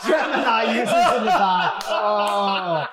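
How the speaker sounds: laughing. Real, not acted. Co-host cracks up at a joke about the audience, laughing. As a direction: repeat it through real laughter.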